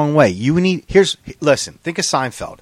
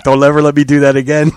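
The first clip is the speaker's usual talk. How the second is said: loud mock plea, mimicking his friend; mock pleading; 2 semitones higher, 8 dB louder, faster than usual. Teasing his buddy about his past, mock pleading. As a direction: ham up a begging friend.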